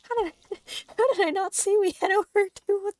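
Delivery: high, loud disbelieving laughter through words; laugh crying. Real, not acted. Streamer laughing at two hundred stockpiled meals, laugh crying. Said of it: "I'm laugh crying a little bit".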